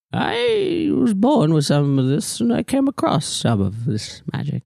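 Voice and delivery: drawn-out character voice, starting high; ancient magical hostess. Acted. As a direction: recall it slowly in an odd, lilting voice. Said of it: "She looks at you and she says".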